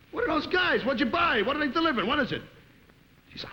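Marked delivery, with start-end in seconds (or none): 0.1-2.4 high-pitched barrage of questions; acted alarm. Husband questioning Edith about the delivery, agitated. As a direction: fire off agitated questions, comically overwrought.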